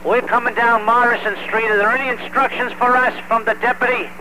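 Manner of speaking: crackly two-way radio voice; calling in over a handheld radio; radio transmission. Real, not acted. Mutual aid company radio, en route.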